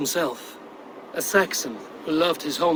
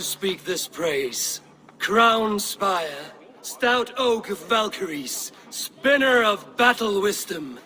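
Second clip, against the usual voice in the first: slow, higher declaiming verse; ceremonial praise toast; 6 semitones higher, 3 dB louder, slower than usual. Acted. Eivor praising Halfdan at the crowning, ceremonial toast. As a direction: recite the praise like a skald.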